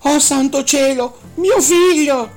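despairing mother screams for her son; desperate cry. Acted. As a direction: shriek in horror at the lost child. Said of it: "gridò disperata".

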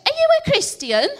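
put-on high, loud Blackpool accent. Blackpool neighbour asking about faith, high, loud Blackpool accent. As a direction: belt it out like a broad Blackpool local. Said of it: "she talked like that 'cause she's from Blackpool".

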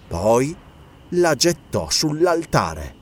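usual speaking voice

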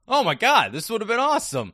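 high, loud thrilled gasp; excited. Retold or imitated. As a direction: gush with delight. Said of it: "I was like".

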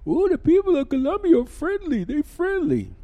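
high, loud mocking singsong; mocking what people say about friendly Colombians; sarcastic mimicry. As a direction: ridicule the cliché as you quote it. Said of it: "but they say".

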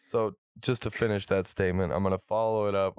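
ordinary delivery

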